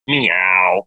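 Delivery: low, drawn-out mock cat call; imitated meow. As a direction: give a mocking meow, pitched well down. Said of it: "he starts meowing".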